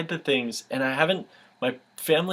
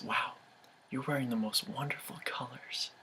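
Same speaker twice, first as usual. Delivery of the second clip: breathy unvoiced whisper, very soft; 13 dB quieter than usual. Mysterious voice whispering compliments to a man at the bar. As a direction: whisper it like a hidden voice.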